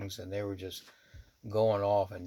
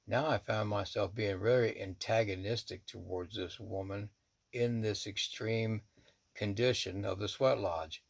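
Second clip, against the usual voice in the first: ordinary delivery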